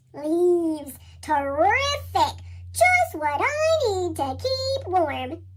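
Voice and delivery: drawn out, pleased and unhurried; slow porcupine. Acted. Porcupine rolling in leaves to keep warm, slow and pleased. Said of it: "said a porcupine".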